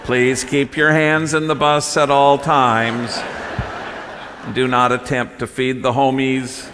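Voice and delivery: laughing mock tour guide announcement; loud, slow, amused tour-guide patter; nature documentary. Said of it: "he pretends he's the tour guide".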